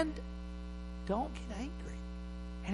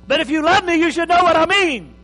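loud, higher pleading wife; mock wife; 9 semitones higher, 10 dB louder than usual. Acted wife in quarrel.

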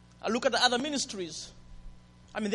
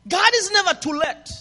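proclaiming; 8 semitones higher, 9 dB louder than usual. Real, not acted. Preacher proclaiming to congregation.

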